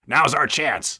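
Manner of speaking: gleeful wicked trucks; cackling. Acted. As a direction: gloat with glee. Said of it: "cackled the trucks".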